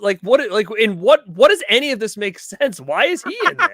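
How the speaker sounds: high-pitched, baffled questioning; incredulous. Real, not acted. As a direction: stumble over the questions in disbelief. Baffled by outlandish party rumor.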